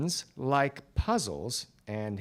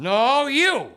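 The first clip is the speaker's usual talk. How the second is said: insistent retort; 9 semitones higher, 5 dB louder than usual. Acted. Standoff with the stranger.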